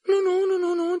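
high, loud character voice; flustered girl's refusal. A narrator's reading. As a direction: voice a high, insistent girl. Hermione refuses Neville in dialogue.

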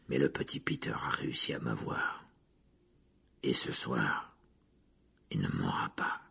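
deep, low menacing villain. A narrator's reading. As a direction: growl it very low, with pauses. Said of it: "grogna-t-il en découvrant ses dents jaunes".

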